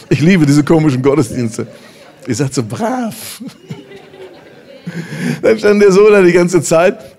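loud, high preaching to the crowd; full-force preaching. Acted. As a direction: rouse the congregation. Preacher shouting to congregation.

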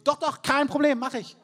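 high, loud protest; eager insistence. Acted. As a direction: Peter insisting he can do it. Petrus insisting against Jesus.

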